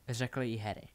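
usual speaking voice

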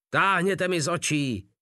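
high-pitched, dismissive command; order to leave. A narrator's reading. Field marshal throwing two soldiers out, sharp order. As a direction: order them out of your sight. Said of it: "nařídil polní maršál".

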